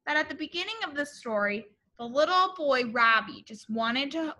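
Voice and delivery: plain reading voice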